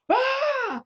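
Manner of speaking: short high-pitched acted scream; retold scream. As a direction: scream as if fighting off an attack. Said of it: "throw a punch and scream".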